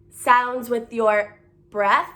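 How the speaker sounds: ordinary delivery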